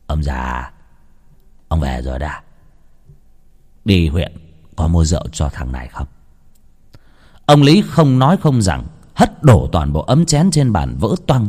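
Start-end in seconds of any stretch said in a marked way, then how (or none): 0.1-6.1 slouch the words, mocking dad; insolent son. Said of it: "Thằng Nhị đi vào".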